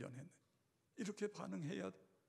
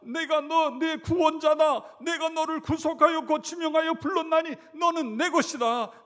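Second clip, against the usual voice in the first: booming proclamation; 10 semitones higher, 18 dB louder than usual. Acted. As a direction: thunder it like God speaking. Voicing God's declaration.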